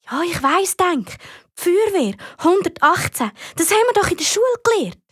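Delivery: put-on high-pitched, know-it-all child's retort; irritated retort. Amy telling Patrick she already knows the number, irritated. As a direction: brush off the needless reminder.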